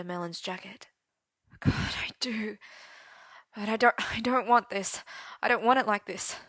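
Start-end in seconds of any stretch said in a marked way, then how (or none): 1.6-2.6 whispered admission. Acted. 3.5-6.4 desperate refusal. Acted. Merlin fighting the desire.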